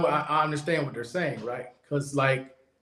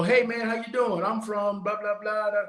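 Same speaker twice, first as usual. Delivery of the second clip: lightly voiced higher-pitched greeting; softer, higher mock small talk; imagined friendly stranger; 5 semitones higher, 3 dB quieter than usual. Imagining a fellow traveler introducing himself, lightly imitated friendly greeting. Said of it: "you're thinking they gonna go".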